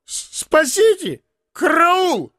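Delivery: very high, drawn-out call for help; stammer, then wail high in panic; terrified cry. A narrator's reading. Beaten comic calling for rescue, terrified cry. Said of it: "закричал он".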